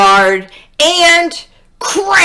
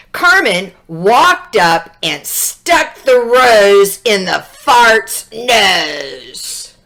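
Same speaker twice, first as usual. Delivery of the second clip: ordinary delivery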